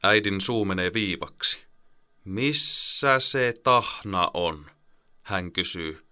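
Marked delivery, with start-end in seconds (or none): 2.2-4.7 slow, each word of "Missä se Tahna on" pressed separately; every word stressed. Acted. Annoyed mother asks her sons, stressing each word slowly. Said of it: "painottaa jokaista sanaa".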